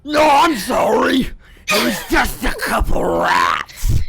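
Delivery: strained, loud, slow forced human voice; best human voice. Acted. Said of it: "your best human language voice".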